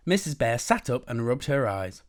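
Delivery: plain reading voice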